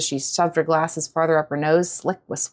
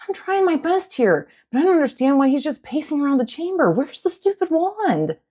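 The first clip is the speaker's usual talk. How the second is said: frustrated; 8 semitones higher than usual. Acted. Frustrated girl answering friend.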